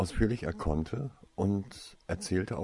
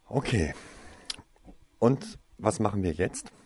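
louder, brisk and eager; a touch of energetic drive; 3 semitones higher, 3 dB louder than usual. A narrator's reading. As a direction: push forward, ready to act. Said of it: "fuhr dann energisch fort".

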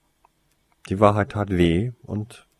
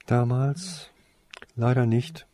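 higher-pitched, halting regret; sad; 6 semitones higher than usual. A narrator's reading. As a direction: answer sadly, with a pause. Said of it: "antwortete Toriesa traurig".

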